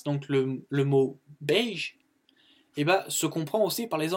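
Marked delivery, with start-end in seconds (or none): 1.4-2.0 beige drawn out as "behzh"; inverse variant. Shown as a demonstration. Teacher gives the other accepted form, slow beige as "behzh". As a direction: say beige slowly with an "eh" vowel.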